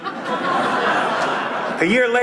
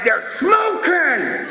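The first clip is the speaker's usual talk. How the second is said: outraged discovery; 8 semitones higher, much slower than usual. Retold or imitated. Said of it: "I'm like".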